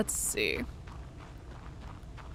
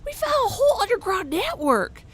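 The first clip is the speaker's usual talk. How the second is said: higher, louder find; excited digger; 10 semitones higher, 3 dB louder than usual. Acted. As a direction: announce the discovery eagerly. Voicing the digger NPC.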